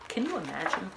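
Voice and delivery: ordinary delivery, nothing marked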